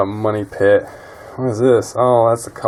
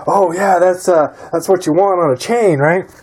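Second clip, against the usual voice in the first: mock know-it-all; 5 semitones higher than usual. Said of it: "even though I say".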